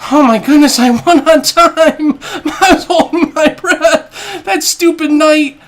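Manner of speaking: ecstatic high shrieking with laughter; shrieking jackpot winner. Real, not acted. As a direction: scream it like you just hit the jackpot. Won on time, screaming with joy.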